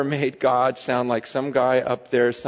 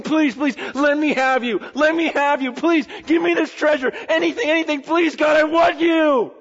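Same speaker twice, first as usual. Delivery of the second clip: higher, louder pleading cry to God; desperate begging; 13 semitones higher, 4 dB louder than usual. Retold or imitated. Worshipper on their face pleading with God, loud desperate begging. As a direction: beg like someone on their face before God. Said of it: "we should be on our faces going".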